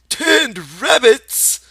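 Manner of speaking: high, loud, very slow sneer; scornful. Acted. Imaginary rabbit mocks Lenny, slow loud scorn. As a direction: drag it out with scorn. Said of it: "it said scornfully".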